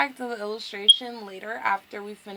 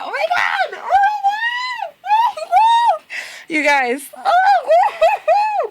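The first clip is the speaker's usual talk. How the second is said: very high, loud squeals with laughter; fangirl screaming; 15 dB louder, slower than usual. Real, not acted. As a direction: lose it completely, shrieking and laughing. Fangirl reaction to in-game confession.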